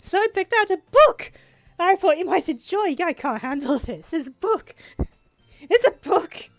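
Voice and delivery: high, very loud freak-out; overwhelmed excitement. Real, not acted. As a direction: shout as if you can't cope with the thrill. Keeps freaking out over the book.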